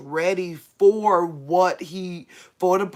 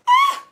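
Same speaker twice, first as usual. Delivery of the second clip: very high, loud shriek; scared shriek; 7 dB louder than usual. Real, not acted. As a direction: scream in fright. Shriek at mouse.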